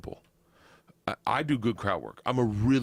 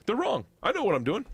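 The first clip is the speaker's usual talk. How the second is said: high, lightly voiced defiant leader; a hint of an unshakeable statesman; Winston Churchill; 11 semitones higher than usual. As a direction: brush off criticism with lofty certainty. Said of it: "just almost like Winston Churchill".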